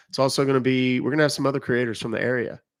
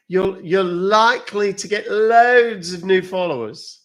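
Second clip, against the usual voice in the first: high, loud, drawn-out character delivery; slow theatrical high-pitched announcement; put-on character voice; 7 semitones higher, 5 dB louder, slower than usual. Character voice predicts new followers.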